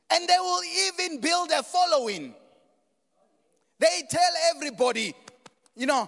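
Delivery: high, very loud pulpit shout; full-force preaching. Real, not acted. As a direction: drive each phrase home loudly, with pauses between.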